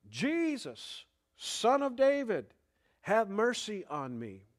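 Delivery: high, loud plea of a blind beggar; crying out. Retold or imitated. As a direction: call out desperately for mercy, pitched high. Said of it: "So he starts yelling".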